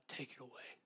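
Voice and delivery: hushed, low plea; retold begging. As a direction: beg softly, as if on your knees. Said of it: "begs God".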